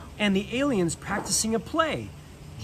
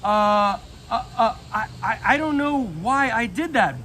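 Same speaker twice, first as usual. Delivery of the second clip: flustered stammering apology; apologetic stammer; 4 semitones higher, 3 dB louder than usual. Acted. Buzz apologizing to Jessie after dipping her, stammering. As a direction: stumble over the words, embarrassed. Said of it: "Buzz said apologetically".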